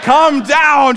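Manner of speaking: high, loud frantic shout; mother shouting at her toddler on the roof; mom yelling. Retold or imitated. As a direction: yell like a scared mother. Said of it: "she yells".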